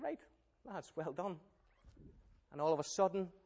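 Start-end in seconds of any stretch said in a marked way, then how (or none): 0.0-1.4 dry sarcasm. Acted. God sarcastic to the grumblers.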